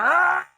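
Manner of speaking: a sudden high shout of frustration; a short, loud cry of frustration; frustrated yell. Shown as a demonstration. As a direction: let out a loud, high vent.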